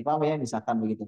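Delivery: usual speaking voice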